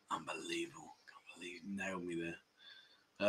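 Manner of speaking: whisper. Real, not acted. Streamer whispering after a viewer beats him. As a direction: whisper it in disbelief to yourself.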